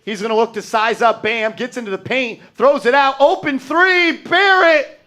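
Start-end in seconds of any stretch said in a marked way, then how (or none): 2.5-5.0 rising high, loud play-by-play call; excited call. Real, not acted. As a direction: call the open three as it happens. Live call of open three.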